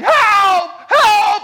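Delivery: high, drawn-out shouted plea; loud acted cry for rescue; screaming for help. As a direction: re-enact a desperate scream at full volume. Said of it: "he starts screaming".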